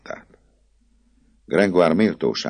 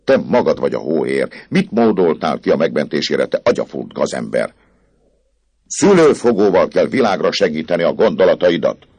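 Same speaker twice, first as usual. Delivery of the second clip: louder furious scolding; angry outcry; 7 semitones higher, 9 dB louder than usual. A narrator's reading. Dom Claude berates Gringoire, loud and furious. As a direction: lash out at the scheming poet. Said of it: "kiáltott fel Dom Claude".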